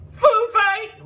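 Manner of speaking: very high, loud gleeful holler. A narrator's reading. Kids hollering as pies fly, loud and high. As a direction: yell it like a battle cry. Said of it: "we all hollered".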